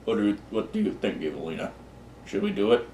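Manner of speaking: lightly voiced devil, soft and low with a lifted question; hushed attempt at a devil's voice; devil character. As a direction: quietly imitate a devil consulting his daughter. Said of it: "the devil look, looks at his daughter and goes".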